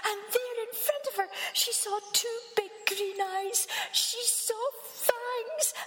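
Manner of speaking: high, rising dramatic narration; excited telling. A narrator's reading. Storyteller at the scary moment, high dramatic. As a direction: build the fright.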